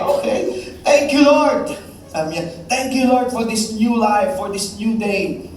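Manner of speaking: drown out the heckler with praise; neighbour keeps thanking the Lord over the shouting. Acted.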